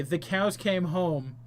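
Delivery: normal talking voice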